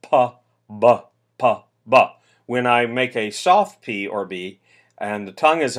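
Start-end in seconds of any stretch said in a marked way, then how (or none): 0.0-2.1 Pa, ba said with bouncy front-lip b; bouncy timpani-like Pa, ba; regular hard p and b. Shown as a demonstration. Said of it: "a very bouncy kind of sound".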